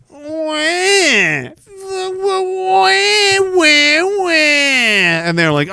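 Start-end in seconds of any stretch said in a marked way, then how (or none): 0.1-4.3 much higher mock baby wail. Newborn Janice crying, mock wail. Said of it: "the baby started crying like this".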